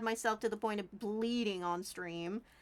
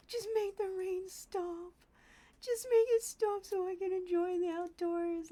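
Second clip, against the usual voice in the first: dog voice; 8 semitones higher than usual. Acted. Voicing the dog's pleading.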